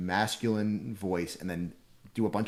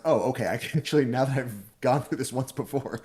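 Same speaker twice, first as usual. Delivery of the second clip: fast, higher and louder, a burst of relief; sudden realization; 6 semitones higher, 4 dB louder than usual. Retold or imitated. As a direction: blurt it out as it finally clicks. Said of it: "it's like".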